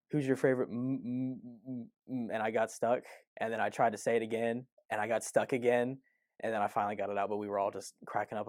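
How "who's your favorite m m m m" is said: retold stutter. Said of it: "I kept stuttering on the word Marvel".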